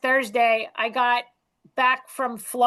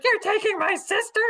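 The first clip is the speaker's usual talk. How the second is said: dog voice; 10 semitones higher than usual. Retold or imitated. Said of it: "they're like".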